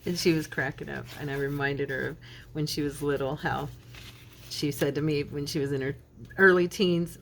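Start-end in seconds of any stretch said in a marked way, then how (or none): none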